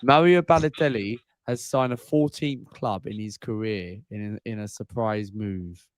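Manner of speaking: lightly put-on Italian accent. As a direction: announce it with a hint of Italian accent. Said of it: "You said that in the Italian accent then".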